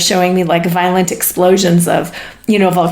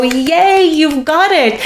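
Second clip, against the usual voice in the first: higher-pitched "yay" of celebration; happy, excited cheer; 7 semitones higher, slower than usual. Acted. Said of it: "they're so excited for us".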